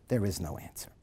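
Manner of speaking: low, soft, fading to a whisper; said sadly. Retold or imitated. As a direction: let the hope drain out, near whisper. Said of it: "he said sadly".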